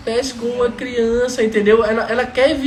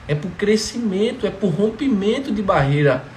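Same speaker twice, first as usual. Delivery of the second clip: ordinary talk, nothing marked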